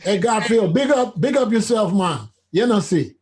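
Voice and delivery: put-on Jamaican patois greeting; Jamaican accent. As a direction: call out in a playful Jamaican accent. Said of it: "throw in the Jamaican accent".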